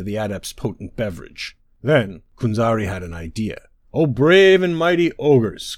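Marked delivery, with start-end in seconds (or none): 3.9-5.8 loud, slow flattering call. Acted. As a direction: call out to the ogres from the hill. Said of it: "Kunzari called out".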